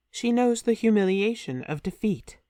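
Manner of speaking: usual speaking voice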